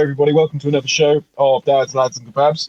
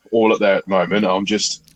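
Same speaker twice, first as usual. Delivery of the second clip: usual speaking voice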